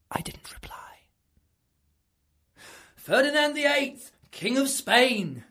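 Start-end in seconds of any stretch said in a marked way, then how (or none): 3.0-5.4 high, slow ceremonious call. Retold or imitated. Inquisitor summons the hiding king, loud and slow. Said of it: "he started calling out".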